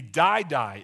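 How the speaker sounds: the verb doubled, first die loud and punched; emphatic die die. Shown as a demonstration. Preacher stressing the doubled die to the congregation, emphatic. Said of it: "It's emphatic".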